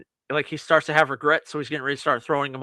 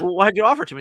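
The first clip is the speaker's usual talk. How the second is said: louder, higher exasperated question; mock indignant complaint; 5 semitones higher, 6 dB louder, much faster than usual. Caller to customer service after two refusals, mock indignant. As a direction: protest it, pitched up and louder.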